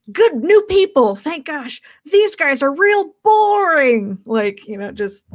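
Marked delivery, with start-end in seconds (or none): 0.1-4.2 high, slow, loud pigman showman; hammed-up relief with a drawn-out groaning complaint; relieved pigmen. Game master voices pigmen welcoming new performers, high exaggerated relief. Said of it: "the pigmen's just like".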